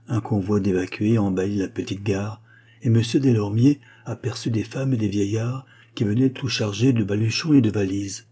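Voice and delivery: ordinary delivery, nothing marked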